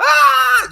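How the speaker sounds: mock high-pitched scream; excited camera-hog yell. People jumping up at the camera, mock excited shriek. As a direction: let out a sudden, high, loud "Ah!" in imitation.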